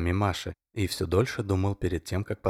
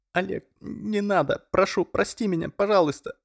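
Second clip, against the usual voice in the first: begging; 12 semitones higher, 4 dB louder than usual. A narrator's reading. Masha begs Oleg to let go of her hair, much higher. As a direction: plead for mercy. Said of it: "взмолилась Маша".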